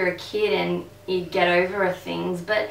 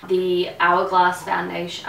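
ordinary talk, nothing marked

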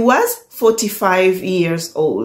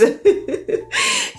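a sudden high laugh that falls away; high burst of laughter; 4 dB quieter than usual. Real, not acted. Laughs at her own story.

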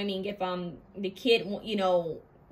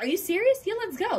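eager yes; 10 semitones higher than usual. Retold or imitated. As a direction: jump at the idea, high. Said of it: "You're gonna be like".

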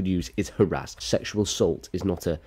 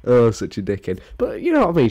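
usual speaking voice

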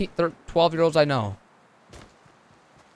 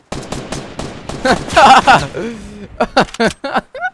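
laugh; 9 semitones higher, 9 dB louder than usual. Real, not acted. Laughing at the chaos.

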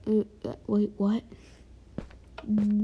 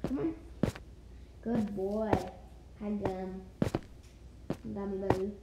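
ordinary delivery

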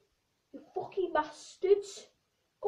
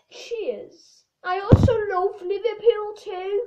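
hammed-up English accent, higher and louder; 4 semitones higher, 6 dB louder than usual. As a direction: put on a broad English accent. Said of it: "try to make accents like English accents".

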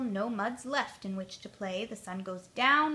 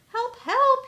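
much higher, louder yell; loud cry for help; 13 semitones higher, 10 dB louder than usual. Acted. Stuck bear shouts to Pig for help, loud. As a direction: cry out for help at full volume. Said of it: "he cries loudly".

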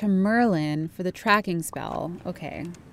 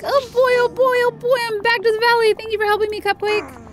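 duck voice; 8 semitones higher, 5 dB louder than usual. Acted. Voicing Donald Duck.

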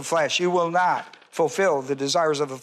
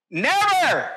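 NEVER high and forceful; absolute, high-pitched NEVER; emphatic never; 9 semitones higher, 3 dB louder than usual. Shown as a demonstration. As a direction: punch NEVER way up in pitch, then drop. Demonstrates the strong emphatic negative.

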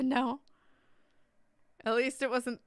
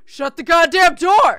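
very loud, high, drawn-out yell; furious ghost shout; 9 semitones higher, 18 dB louder, slower than usual. Acted. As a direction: bellow it slowly, word by word. Plays furious ghost behind door.

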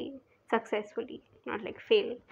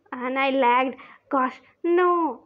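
frustrated outburst; 2 semitones higher, 7 dB louder, much slower than usual. Real, not acted. Lag panic in chase game.

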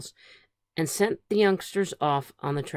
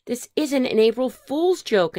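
higher-pitched earnest protest; insistent; 8 semitones higher, 4 dB louder than usual. A narrator's reading. Phyllis tells the others it is no joke, insistent. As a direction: insist in a raised storybook voice. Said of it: "insisted Phyllis".

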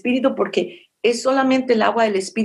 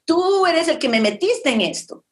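reproaching God; 7 semitones higher than usual. Retold or imitated. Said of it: "pero ella está reclamando".

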